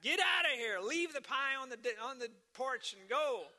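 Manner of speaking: loud, hostile shout; angry yell. Retold or imitated. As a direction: yell to chase them off. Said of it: "he called out again through that crack".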